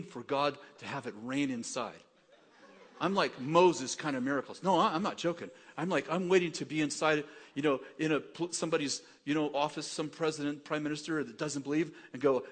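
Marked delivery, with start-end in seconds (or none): none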